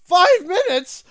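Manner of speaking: exasperated outcry at how little time passed; incredulous. Retold or imitated. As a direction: stretch it out in disbelief. Bored Spyro exclaims at Sparks.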